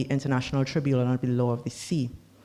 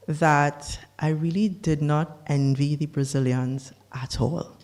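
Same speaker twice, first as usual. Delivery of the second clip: ordinary talk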